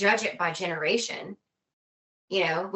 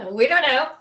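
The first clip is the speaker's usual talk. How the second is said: laughing; 6 semitones higher, 7 dB louder than usual. Real, not acted. Laughing at having no answer.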